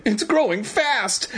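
put-on slow high-pitched alarmed kid; high urgent boy. A narrator's reading. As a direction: stretch it out, high and panicky. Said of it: "Evan declared".

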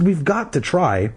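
high, urgent insistence; acted pleading insistence; insistent. A narrator's reading. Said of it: "Ashley insisted".

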